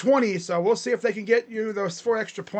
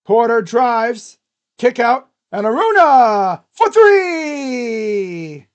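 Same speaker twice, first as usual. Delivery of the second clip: excited commentary; 4 semitones higher, 12 dB louder, much slower than usual. Real, not acted. Hyping a three-pointer.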